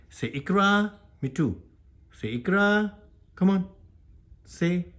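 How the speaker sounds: high-pitched pet-training call; coaxing a pet. Real, not acted. Prompting pet to repeat words.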